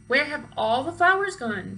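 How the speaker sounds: loud, outraged demand; shout. A narrator's reading. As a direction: bellow it across the yard. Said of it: "shouted Eugenia".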